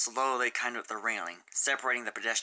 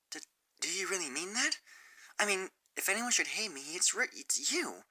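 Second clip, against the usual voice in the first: higher, soft, halting apology; nervous, picking each word; hesitant Sunset; 8 semitones higher, 4 dB quieter than usual. Acted. Sunset apologizing to Fluttershy, soft and halting.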